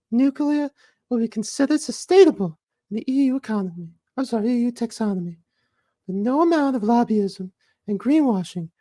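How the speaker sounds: squeaky high mock activist reading the tweet; Greta Thunberg impression. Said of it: "read it in my Greta Thunberg first".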